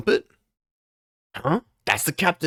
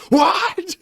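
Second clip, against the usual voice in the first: shrieked, squealing with laughter, much higher and louder; laughing mock cry of disbelief; laughing "what"; 7 dB louder than usual. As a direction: crack up while squeaking out the quote. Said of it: "They're going".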